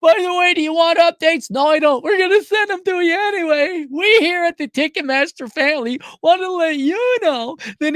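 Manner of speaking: high, loud put-on promo voice; mock Ticketmaster announcer. Company pushing unwanted updates on a customer, mock announcer.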